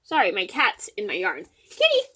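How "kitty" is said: very high-pitched pet call; calling a cat. Real, not acted. Cat owner to her kitty in the yard, high calling voice.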